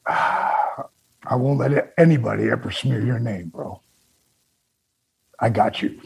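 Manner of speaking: fighting back tears, ending in a near-whisper; real grief pushing through a goodbye; choked up. Real, not acted. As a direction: voice breaking into tears, low. Breaking down in a farewell.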